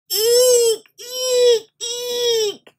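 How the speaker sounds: frightened eeks. Acted. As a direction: squeak out each eek in fright. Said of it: "yelled Sam".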